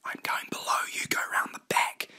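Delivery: whispered orders; soft conspiratorial whisper. Acted. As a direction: give the instructions in a hushed whisper. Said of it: "Shizlev whispered to Rosalia".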